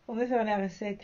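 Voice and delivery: laughing. Real, not acted. Vlogger laughing as she asks followers to bring in sales. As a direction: laugh it out as a joke. Repeats the line, then laughs.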